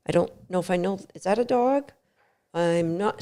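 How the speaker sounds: a dog half-asking itself, barely voiced; hushed unsure dog. Host voicing a cautious dog: soft, unsure murmur.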